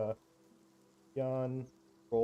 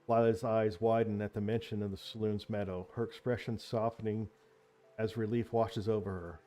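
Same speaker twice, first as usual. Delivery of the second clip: steady storyteller's narration; story narration; 5 dB louder than usual. A narrator's reading. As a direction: set the scene like a game master. GM narrates the scene.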